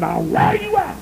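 high, sharp stern warning; a hint of a stern father; father's threat. Father warning his little boy, high stern call.

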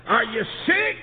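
mother's worried holler. Retold or imitated. Mother hollering down the phone in worry. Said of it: "Mother hollered".

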